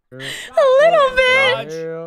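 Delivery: drawn-out whiny mock echo; mocking whine. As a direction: stretch it out like a pouting whine. Mocking friend's reluctant answer.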